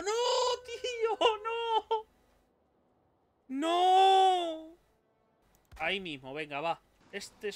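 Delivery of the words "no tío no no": very high, drawn-out cries; real high-pitched despairing yell; panicked wail. Real, not acted. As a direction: wail in dismay, ending in a loud scream. Wolf attack in game.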